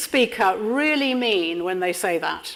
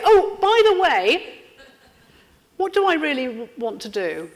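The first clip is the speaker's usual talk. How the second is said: breezy high offhand aside, slowed; airy by-the-way tone hiding the big point; casual throwaway; 4 semitones higher than usual. Shown as a demonstration. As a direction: slip it in like it's nothing minor. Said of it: "I don't have the courage to say it".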